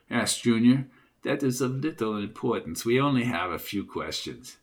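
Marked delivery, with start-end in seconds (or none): 1.2-4.6 put-on low, soft German-accented questioner; quietly dismissive in a German accent. A narrator's reading. German stranger brushing off Junior's question, low German accent. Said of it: "in a distinct German voice".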